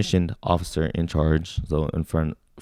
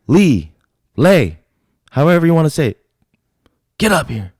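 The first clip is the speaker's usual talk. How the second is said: shouted summons; 10 semitones higher, 9 dB louder than usual. Acted. Drill sergeant calls recruit up for his weapon, shouting. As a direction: drill sergeant's loud, higher-pitched bellow.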